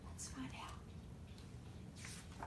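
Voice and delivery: hushed, slow whisper; soft slow secret whisper. Real, not acted. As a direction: lean in and whisper it slowly. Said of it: "whispered aside to listener".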